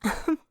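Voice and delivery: light laugh. Acted. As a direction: laugh gladly at seeing him. The lady greets the prince warmly.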